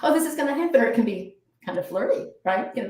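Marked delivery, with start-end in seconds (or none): 0.0-0.8 high, breathy thrill; bubbling over with anticipation; giddy, higher excitement; excited. Shown as a demonstration. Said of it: "But it can also be".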